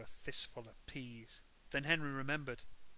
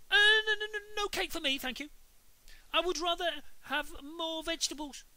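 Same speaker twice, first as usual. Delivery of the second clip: much higher, loud goody-goody voice; Henry acting perfect, declining cake; prim perfect Henry; 10 dB louder than usual. A narrator's reading. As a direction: refuse like a little saint.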